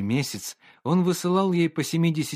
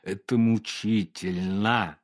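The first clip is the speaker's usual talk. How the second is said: slow, tight words forced through teeth; through gritted teeth; 3 semitones lower, slower than usual. Acted. Painter grinds out his torment to Olga through teeth. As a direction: grind it out between clenched teeth. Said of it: "процедил сквозь зубы художник".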